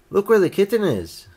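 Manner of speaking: high, loud call to look; noisy shout. A narrator's reading. Nicky points out the kitten to everyone, shouting noisily. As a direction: call out urgently, pitch up. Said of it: "It was a noisy shout".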